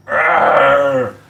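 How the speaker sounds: loud strained roar of emotion; anguished groan. Real, not acted. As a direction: let out a choked-up arrgh. Overcome with emotion, chokes up.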